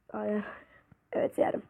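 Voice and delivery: soft unvoiced whisper to himself; whispered aside. Real, not acted. Gamer answering himself, whispered aside. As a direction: murmur it under your breath. Asks self quietly.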